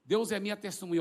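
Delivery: ordinary talk, nothing marked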